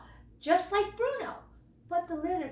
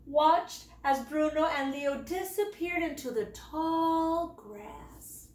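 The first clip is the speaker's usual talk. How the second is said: usual speaking voice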